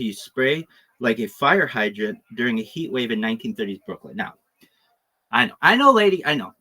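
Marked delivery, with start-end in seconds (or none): 5.3-6.5 high, loud I know, I know, lady; mock placating. Host cutting off an imagined listener's protest, high loud mock placating. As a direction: wave off an imagined complaint.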